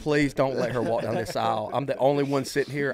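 loud, desperate plea to God; frantic retold prayer; pleading prayer. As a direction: beg out loud, panicked. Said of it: "I'm literally praying in church".

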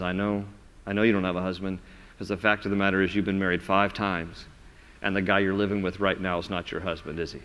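know it all and say it kindly; gentle knowing. Pretend. Jesus softly telling the woman he knows her past.

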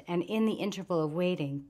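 ordinary delivery

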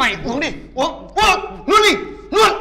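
starts firm, climbs to a loud high shout; mock shout forcing her to swallow; building to a shout.

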